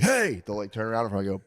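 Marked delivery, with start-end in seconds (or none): 0.0-0.4 high, sharp call. Shown as a demonstration. Dad calling out sharply to the kids. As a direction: snap their attention with a call. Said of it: "If I'm like".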